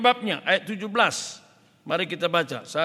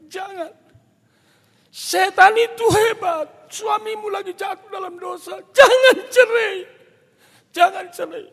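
very high, loud tearful begging; acted wailing plea; crying plea; 13 semitones higher, 9 dB louder than usual. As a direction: beg desperately as if weeping, re-enacted. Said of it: "Saya duluan nangis kepada dia".

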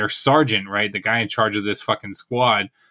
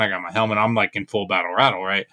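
ordinary delivery

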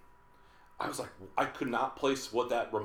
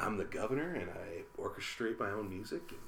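Clint Eastwood impression; 3 semitones lower, 11 dB quieter than usual. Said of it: "say it like Clint".

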